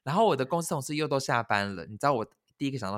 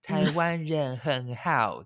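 put-on slow, careful foreign-accented Chinese; foreigner's Chinese; 3 semitones higher, much slower than usual. Korean coworker praising Taiwan in slow learner's Chinese. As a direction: spell out each word like a learner.

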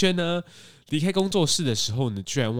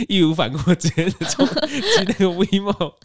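slow, words broken by laughs; laughing; 7 semitones higher, 5 dB louder, slower than usual. Real, not acted. Recalling the speeding scooter ride, laughing slowly. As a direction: giggle your way through it slowly. Laughing at his own story.

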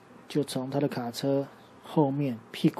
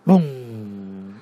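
模仿炮声; 4 semitones lower, 6 dB louder than usual. Acted. 镭射炮打天花板.

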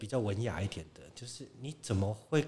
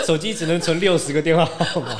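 loud, high line bursting with laughter; laughing; 6 semitones higher, 12 dB louder than usual. Real, not acted. Guest mocks tiny old phone memory, laughing loudly. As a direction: shout it through laughter.

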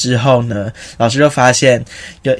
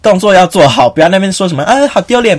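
a hint of a strict teacher, higher and louder; scolding teacher; 6 semitones higher, 4 dB louder than usual. Teacher scolding students at rehearsal, mock scolding. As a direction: lecture the class sharply. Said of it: "他就说".

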